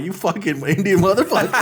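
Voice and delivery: amused exasperated cursing; half-laughing fake outrage at a client; mock exasperated curse. Agent laughing while cursing the haggling client. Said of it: "I'm like".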